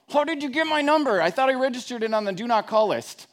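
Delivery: high, loud, outraged squeal; mock outrage at a telemarketer; indignant caller. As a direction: play up the annoyed phone owner. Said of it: "I'm like".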